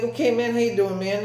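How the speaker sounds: greeting mimicked up high; high, imitated kid-like hello; high-pitched greeting. High-pitched greeting in retold exchange.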